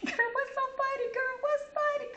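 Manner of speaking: put-on very high, laughing kid's voice. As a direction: squeal it like a giggling girl. Kid demanding why friend laughs.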